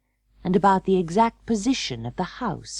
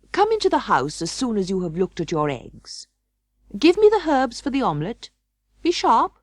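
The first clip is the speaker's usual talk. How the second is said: curt and hurried, rising high and louder at the end; abrupt; 5 semitones higher, 3 dB louder than usual. A narrator's reading. Fox hurrying Jemima into the house, abrupt and impatient. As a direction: snap the orders out fast, impatient. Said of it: "He was rather abrupt".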